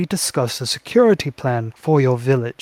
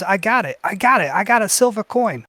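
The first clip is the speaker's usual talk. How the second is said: put-on high, excited little goblin boy; goblin kid; 7 semitones higher than usual. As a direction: brag about the coin like a child. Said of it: "and he says".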